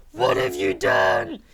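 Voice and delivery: very deep, very loud slow bellow; angry old wizard. A narrator's reading. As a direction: boom it like a furious headmaster. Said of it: "Dumbledore walked in angrily".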